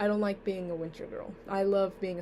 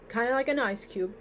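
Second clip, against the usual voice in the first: ordinary delivery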